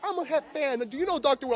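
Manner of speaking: imitating an eager fan. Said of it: "people were like".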